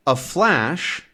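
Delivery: ordinary talk, nothing marked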